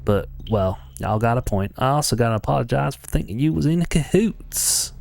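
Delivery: put-on Texan accent; Texan twang for a game character; Milda. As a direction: play it as a folksy Texan. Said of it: "I know that voice doesn't fit her".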